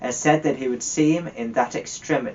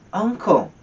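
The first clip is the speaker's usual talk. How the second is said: pleading; 9 semitones higher, 5 dB louder than usual. A narrator's reading. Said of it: "pleaded the nephew".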